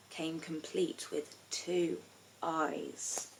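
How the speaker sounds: soft, slow suspenseful drop; hushed, slowed suspense; hushed reveal. A narrator's reading. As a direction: let it hang ominously.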